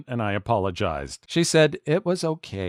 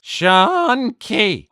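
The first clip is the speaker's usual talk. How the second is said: high cracking teacher's call, louder; 10 semitones higher, 4 dB louder than usual. Retold or imitated. Mandarin teacher starting class, voice cracking high. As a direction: imitate her voice breaking on the name. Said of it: "My Mandarin teacher's voice cracks".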